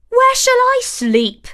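high, loud fretful dwarf; squeaky dwarf. A narrator's reading. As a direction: fuss like a worried little dwarf. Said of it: "asked a third".

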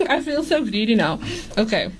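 ordinary talk

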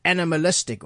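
usual speaking voice

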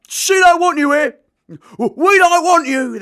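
high, loud, slow and tearful fury; furious shouting. Acted. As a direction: throw it at him as he shakes with rage. Johnny confronting Keith.

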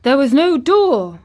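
loud, high-pitched disbelief; astonished boy's protest; cried out. A narrator's reading. Colin insists the garden had no way in, incredulous. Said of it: "cried Colin".

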